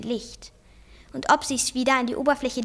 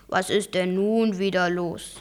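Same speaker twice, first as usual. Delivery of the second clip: low, slow, unruffled voice; conspicuously calm; 6 semitones lower than usual. A narrator's reading. Uncle answering his panicking nephew, very calm. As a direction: ask it slowly with striking calm. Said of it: "mit auffallender Ruhe".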